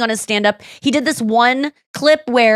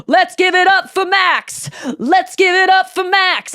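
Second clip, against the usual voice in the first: high, slowed mock chant; rallying the crowd; 9 semitones higher, slower than usual. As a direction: imitate a comic leading a crowd cheer. Retold comic rallying crowd for Max.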